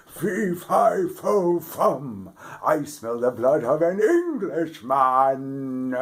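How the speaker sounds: loud, high booming giant; giant's roar. A narrator's reading. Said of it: "a loud voice saying".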